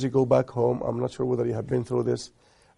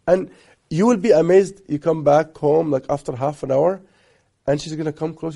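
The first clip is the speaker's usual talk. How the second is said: normal talking voice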